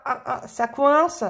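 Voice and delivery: normal talking voice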